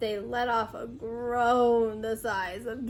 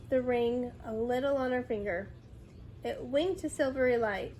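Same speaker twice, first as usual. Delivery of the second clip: ordinary delivery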